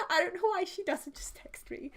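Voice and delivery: real whisper, exasperated aside; annoyed whispered aside. Real, not acted. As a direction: breathe it as a private grumble. Whispered aside about mother.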